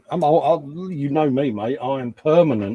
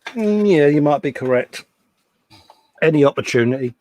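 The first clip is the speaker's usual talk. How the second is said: ordinary delivery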